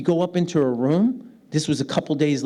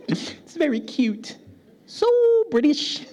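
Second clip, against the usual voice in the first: high, drawn-out teasing coo; mock cooing; 10 semitones higher, slower than usual. Americans tease his British way of speaking, mock cooing. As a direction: gush in an exaggerated high voice.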